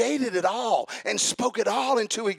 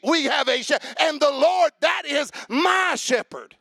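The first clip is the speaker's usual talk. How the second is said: loud, high, ringing preacher's shout; full-force preaching; 4 semitones higher, 7 dB louder than usual. Acted. Pastor preaching loud to the church about God's protection. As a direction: preach it out big to the congregation.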